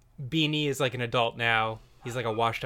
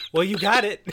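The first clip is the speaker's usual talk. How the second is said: higher, louder mock outburst; acted Cecil; 8 semitones higher, 6 dB louder than usual. Cecil answering his own question to camera, mock outburst. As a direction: throw it at the audience, pitched up. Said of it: "Cecil turns to the camera".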